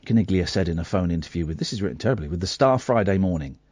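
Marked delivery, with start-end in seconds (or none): none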